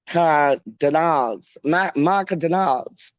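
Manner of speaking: slow Macadonalds with an extra syllable; posh pronunciation. Shown as a demonstration. Host tries posh McDonald's, slow Macadonalds. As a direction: draw out Ma-ca-donalds. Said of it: "That sounds like posh".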